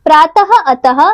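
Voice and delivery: प्रातः, अतः ending in an audible "ha"; visarga like ह. Shown as a demonstration. Teacher gives visarga examples, प्रातः and अतः ending in a "ha" sound. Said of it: "इसका उच्चारण ह की तरह होता है".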